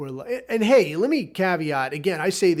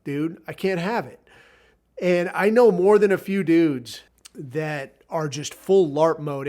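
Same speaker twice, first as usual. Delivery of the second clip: ordinary talk, nothing marked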